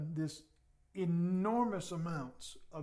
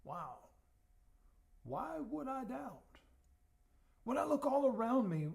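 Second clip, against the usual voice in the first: wondering wow; 5 semitones higher than usual. Acted. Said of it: "not as a statement of guilt".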